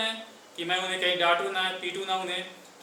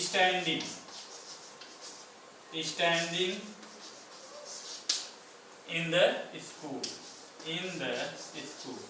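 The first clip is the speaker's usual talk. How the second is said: each word spaced out, softer toward the end; slow dictation; 6 dB quieter, much slower than usual. Shown as a demonstration. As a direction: spell it out for the class to copy. Teacher dictating English words. (धीरे-धीरे अंग्रेज़ी लिखवाना)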